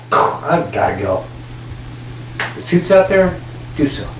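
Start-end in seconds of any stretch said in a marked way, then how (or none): 0.1-1.2 low deadpan. Pretend. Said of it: "You just wanna get up and say".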